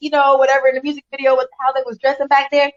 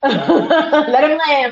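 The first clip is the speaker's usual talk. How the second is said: slow, laughing through it; drawn out between laughs; laughing while saying it; 3 semitones higher, much slower than usual. Real, not acted.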